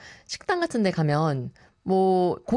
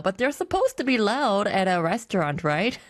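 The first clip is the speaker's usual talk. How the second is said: ordinary talk, nothing marked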